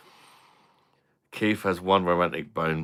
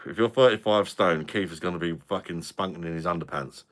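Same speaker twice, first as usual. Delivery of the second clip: ordinary delivery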